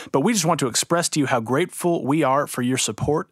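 ordinary talk

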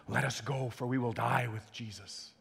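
low, hushed solemn resolve; grave, softly spoken determination; resolute. Acted. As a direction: steel yourself quietly and go to your death. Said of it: "is Thomas being resolute?".

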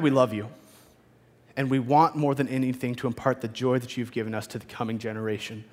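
soft, hushed closing prayer. Real, not acted. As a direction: pray quietly and humbly. Pastor leads closing prayer.

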